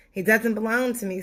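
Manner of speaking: ordinary delivery, nothing marked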